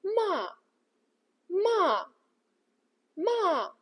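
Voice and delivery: Ma! dropping sharply, loud and high; fourth tone sharp. Shown as a demonstration. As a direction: bark ma like a command. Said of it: "the fourth tone is sharp, like you are giving the command".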